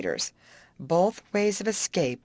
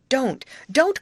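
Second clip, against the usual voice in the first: high, loud protest; urgent storybook plea; urgent cry; 9 semitones higher, 5 dB louder than usual. A narrator's reading. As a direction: cry out to stop them. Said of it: "cried Molly".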